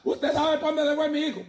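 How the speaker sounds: high, tearful plea from a frantic mother; mother asking through tears, pitched high; mock-tearful mother searching for her son; crying mother. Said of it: "llorando y le decía".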